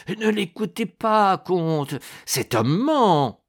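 high, strained cry, last word drawn out; desperate warning. A narrator's reading. The dragging monk begs the count not to listen, desperate. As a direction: plead loudly to be believed.

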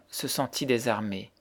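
plain reading voice

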